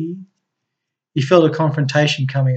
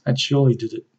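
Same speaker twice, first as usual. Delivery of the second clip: ordinary delivery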